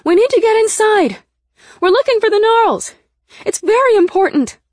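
high, sustained shouting; loud, high-pitched calling; crying out urgently. A narrator's reading. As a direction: shout pleadingly to whoever is inside. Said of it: "I cried".

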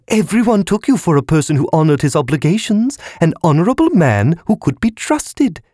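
very high scolding squeak; shrill and nagging, like a balloon. A narrator's reading. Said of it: "the balloon shrilled".

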